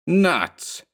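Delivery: sharp high scornful bark. Acted. Gang boss dismisses vampire story, scornful high bark. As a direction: spit it out, scoffing at ghost talk. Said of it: "scornfully biting a cigar".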